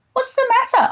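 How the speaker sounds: high, gentle asking voice; high kind question. Acted. Giant asking the bleating goat, high and kind. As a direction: pitch it up and ask kindly.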